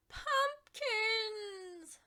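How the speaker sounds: high, loud shriek. A narrator's reading. Rebecca Estelle seeing the overrun yard, shrieking. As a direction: shriek at the sight of the pumpkins. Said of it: "Rebecca Estelle shrieked".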